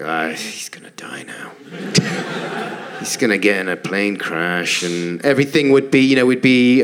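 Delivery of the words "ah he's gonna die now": breathy whispered dread; anxious whisper. Retold or imitated. Boy fearing his dad's flight, whispered dread. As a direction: whisper it like a scared child. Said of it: "I was like".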